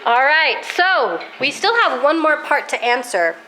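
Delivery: ordinary talk, nothing marked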